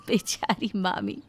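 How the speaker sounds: a short high laugh, then sighs over the poor aunt; pitying laugh. A narrator's reading. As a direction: laugh it off with mock pity. Narrator pities overworked aunt.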